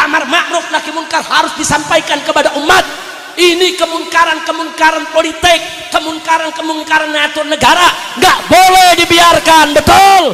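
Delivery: high, forceful declaration building to a shout; fiery preaching. Real, not acted. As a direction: proclaim it to the whole crowd.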